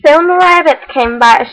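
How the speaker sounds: plain reading voice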